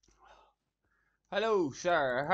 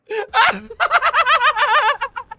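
very high, loud shrieking laughter; 15 dB louder than usual. Real, not acted. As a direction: laugh till you squeal.

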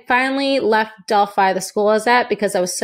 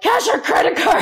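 high, eerie robotic demand; zombie recruiter; 12 semitones higher than usual. Retold or imitated. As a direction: say it like a brainwashed zombie. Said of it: "they're like".

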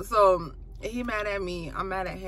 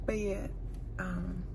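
whispered aside to the camera; whispering; 10 dB quieter than usual. Real, not acted. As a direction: whisper the secret plan. Secret aside about the prank.

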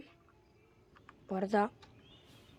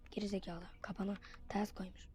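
hushed whisper; 11 dB quieter than usual. Real, not acted. Gamer sneaking past Granny, hushed whisper. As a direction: whisper it so Granny won't hear. Quiet sneaking in horror game.

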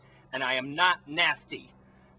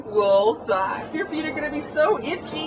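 mocking tease; 12 semitones higher, 6 dB louder than usual. Teasing Wally about his socks.

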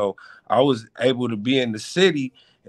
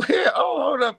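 startled shout; 13 semitones higher than usual. Retold or imitated. When Indians speak patois back, a startled high shout. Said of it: "You be like".

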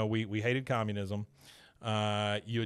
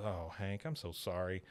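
much softer, lower apologetic voice; soft apology; 5 semitones lower, 9 dB quieter than usual. Retold or imitated. Tilly apologizing to Hank on the phone, soft and low. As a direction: say sorry gently to your son.

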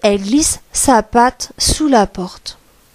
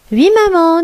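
lightly voiced chorus of little goats, higher; kids answering; 6 semitones higher, 3 dB louder than usual. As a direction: answer mother brightly on a high pitch. Kids answer mother in story.

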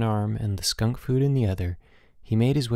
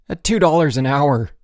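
louder, higher, aggrieved snarl; 5 semitones higher, 8 dB louder than usual. A narrator's reading. Boots complains to Bruno about dishwashing pay, snarling. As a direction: throw it out in angry indignation. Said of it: "Boots snarled".

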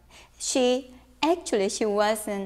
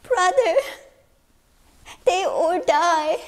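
put-on high, louder girl voice; little sister voice; 8 semitones higher, 5 dB louder than usual. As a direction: play the lonely girl. Sister voice again.

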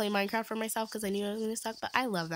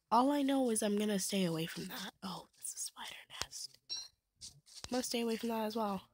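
whispering; 5 dB quieter, faster than usual. Real, not acted. Hushed aside on danger.

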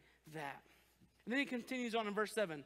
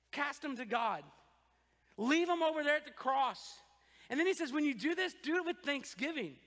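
ordinary delivery

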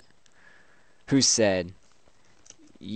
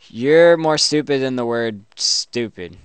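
raised voice, falling away at the end; loud mock insult; 2 semitones higher, 7 dB louder than usual. Player trading insults with chatbot, loud mock annoyance. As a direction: come in loud on the comeback.

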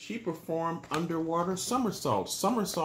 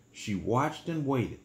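ordinary delivery

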